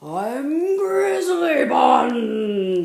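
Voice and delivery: slow, very loud roar. Acted. Grizzly Bun roaring her name at Bunny Bear. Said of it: "she roared".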